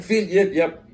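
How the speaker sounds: high-pitched calling after a friend; retold calling. Friends calling Finn back at bag check, retold calling.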